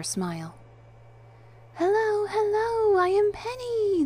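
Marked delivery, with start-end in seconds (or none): 1.7-4.0 high, loud, drawn-out cheery hello; cheerful greeting. A narrator's reading. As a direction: sing out a bright, bubbly angel's welcome. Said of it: "the angel greeted us cheerfully".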